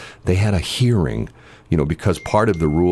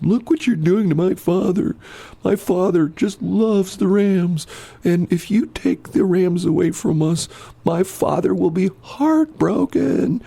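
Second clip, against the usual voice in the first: much higher, slow, pleading voice of a distressed middle-aged woman; upset woman pleading; 13 semitones higher, slower than usual. Retold or imitated. Woman at the hearing begs the officials, high slow pleading. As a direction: beg them tearfully as the daughter defending her old father. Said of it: "she stands up and she says".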